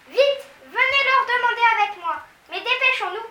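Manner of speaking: high, loud hurry; urgent high girl's cry. A narrator's reading. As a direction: rush her friend along. Mathilda hurrying.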